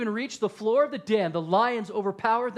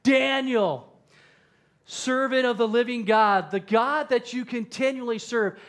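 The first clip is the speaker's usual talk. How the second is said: raised, urgent calling voice; anguished yell; 3 semitones higher, 4 dB louder than usual. Acted. Anxious king yells at the pit to Daniel, anguished call. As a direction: call out in anguish, building toward a cry. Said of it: "he called to Daniel in an anguished voice".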